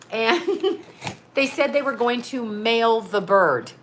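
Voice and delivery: ordinary delivery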